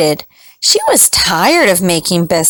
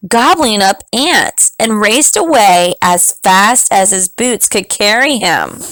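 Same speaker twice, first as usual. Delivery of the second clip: ordinary delivery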